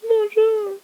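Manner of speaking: voix d'enfant très aiguë qui appelle; voix de gamin. Acted. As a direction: fais le gamin enjoué qui invite. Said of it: "je fais cette voix, là, euh, voix de gamin". (un gamin accueille les visiteurs sur le ponton, voix très aiguë)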